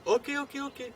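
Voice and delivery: high-pitched, hurried-to-agree okays; placating concession. Acted. Acted giving in to his critics.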